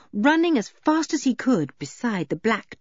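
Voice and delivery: usual speaking voice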